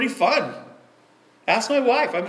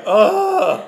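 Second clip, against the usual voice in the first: exasperated groan; 10 semitones higher, 6 dB louder than usual. Retold or imitated. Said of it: "Amy's like".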